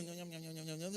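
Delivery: nibbling nham-nham, starting low and soft, climbing; munch away like a carefree sheep; imitated sheep munching. Preacher mimics a sheep grazing without a care, playful munching noises. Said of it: "a ovelha tá lá comendo a graminha".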